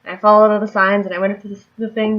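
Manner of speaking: ordinary delivery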